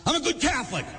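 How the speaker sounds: high-pitched mock piety; mocking self-righteousness. Priest mimicking a hypocritical churchgoer, mocking self-righteousness. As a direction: parody a proud believer in a high voice.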